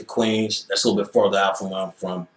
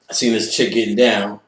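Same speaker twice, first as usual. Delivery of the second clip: usual speaking voice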